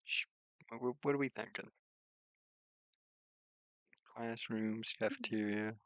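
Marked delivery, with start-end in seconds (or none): none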